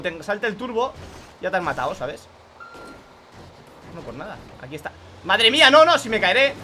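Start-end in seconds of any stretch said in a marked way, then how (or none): 5.2-6.5 loud panicked cry; alarmed outburst. Real, not acted. Gamer panicking as the bus nearly falls. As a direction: gasp it out as the bus tips.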